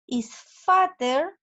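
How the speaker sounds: "is fatter" drawn out very slowly, stress on "fatter"; slow, stretched "fatter". Shown as a demonstration. Teacher reading the example sentence to the class, slowly on "fatter". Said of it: "Y la oración aquí me dice".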